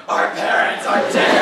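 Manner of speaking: loud, slow theatrical yell; mock yelling. Cosplaying friends in the closet, a mock dramatic yell. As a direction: bellow it like a tragic superhero. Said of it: "We just go yelling it".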